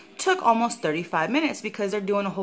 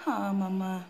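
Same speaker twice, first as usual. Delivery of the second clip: usual speaking voice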